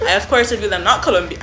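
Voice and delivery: ordinary delivery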